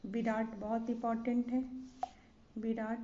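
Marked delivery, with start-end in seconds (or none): none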